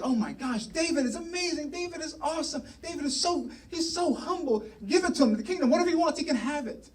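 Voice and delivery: high-pitched, mock gushing adoration; gushing crowd. Everybody reacting to humble David, high-pitched gushing. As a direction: imitate a swooning crowd of fans. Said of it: "everybody's like".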